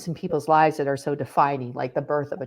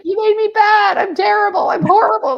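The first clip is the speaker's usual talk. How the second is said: much higher, louder mock wail of self-blame; self-condemning outburst; 9 dB louder than usual. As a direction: cry it out in dramatized self-reproach. Acts out her anguished answer to God.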